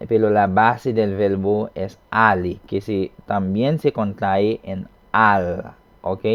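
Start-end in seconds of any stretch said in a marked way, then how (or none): none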